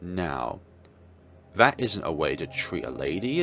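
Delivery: ordinary delivery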